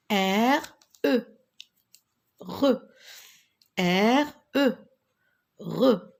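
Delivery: R, E, then blended into "Re"; teacher modelling "Re" with pauses; spelling aloud. Shown as a demonstration. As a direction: sound out R and E separately, then say "Re". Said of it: "Répétez avec moi".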